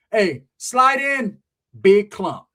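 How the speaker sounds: high, slow hype call to the crew. Retold or imitated. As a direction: stretch it out like hollering at the homies. Calling the crew to come along.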